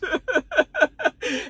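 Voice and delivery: real high giggle at own silliness; amused laughing fit. Real, not acted. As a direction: break up into high, helpless laughter. Cracks up at own accent.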